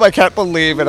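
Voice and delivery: normal talking voice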